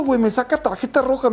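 ordinary talk, nothing marked

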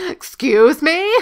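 high, loud, drawn-out outrage; indignant disbelief. Acted. Girl affronted at being doubted, indignant disbelief. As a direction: stretch it out, loudly affronted. Girl character's gender is questioned.

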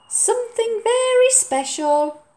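high, lilting; gran teasing. Acted. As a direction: make it a treat. Gran's voice.

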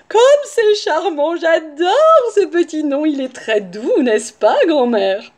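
slow, gushing, honeyed voice; syrupy wolf, loud and drawn out; sugary sweet wolf. A narrator's reading. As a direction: coo with exaggerated delight. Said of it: "prit sa voix la plus douce".

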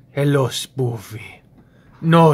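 usual speaking voice

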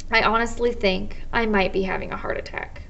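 plain reading voice

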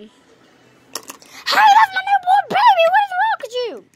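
very high, loud indignant yell; outraged shout. Acted. Mother yelling that the doctor took her newborn, very high and loud. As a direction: scream at him for it.

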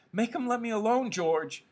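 very high, pleading wail; terrified cry. A narrator's reading. Lenny begs George to stop Curly, terrified. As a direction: beg for rescue in a high cry. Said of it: "Lenny gave a cry of terror".

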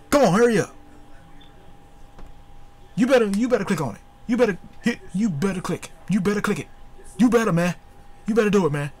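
impatient urging. Real, not acted. Urging the opponent loudly.

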